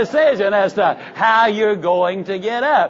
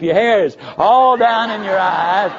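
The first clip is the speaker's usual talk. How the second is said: ordinary talk, nothing marked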